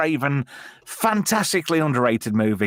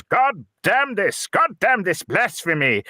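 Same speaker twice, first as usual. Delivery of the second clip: higher, slow, hammed-up preacher damning it; outraged priest; 7 semitones higher, slower than usual. Priest at the exploding TV, slow hammed-up outrage. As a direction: thunder it like a shocked priest. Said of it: "this vicar goes and he goes".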